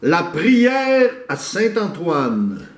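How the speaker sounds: slow, loud proclamation of the title; loud poem declamation. Acted. Proclaiming the prayer to Saint Antoine, loud and slow.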